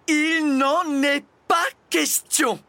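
high, loud, drawn-out outrage; angry roar. A narrator's reading. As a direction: roar a furious refusal, word by word. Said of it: "rugit la maîtresse en colère". (rugissement de colère, aigu et fort)

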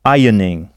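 "Ironing" said as EYE-ning, no R sound; silent R. Shown as a demonstration. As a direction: drop the R in "Ironing". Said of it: "on ne prononce pas le R".

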